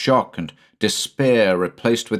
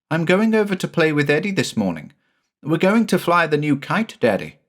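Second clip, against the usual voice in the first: put-on high, quick child voice; eager little boy; 9 semitones higher than usual. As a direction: chatter like a boy excited for play. Jamie voiced in story.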